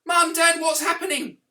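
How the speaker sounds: put-on high, loud little girl calling her parents; young daughter. Daughter running up from the cattle, high and loud. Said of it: "And she said".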